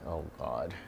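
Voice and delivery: mild despairing aside to herself; muttered exasperation. Retold or imitated. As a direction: mutter it under your breath. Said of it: "And I'm like".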